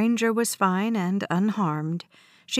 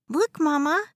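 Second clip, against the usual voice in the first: put-on excited little boy; 7 semitones higher than usual. A narrator's reading. Child voice excited.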